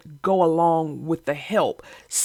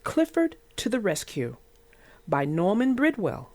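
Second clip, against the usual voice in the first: put-on reading to children; 3 semitones higher than usual. A narrator's reading. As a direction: read it like a bedtime picture book for little kids. Reads picture book aloud.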